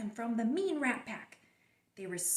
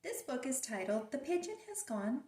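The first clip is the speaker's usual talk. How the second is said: plain reading voice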